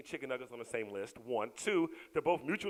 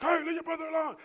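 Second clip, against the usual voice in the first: scolding; 11 dB louder than usual. Retold or imitated. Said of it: "And I'm like".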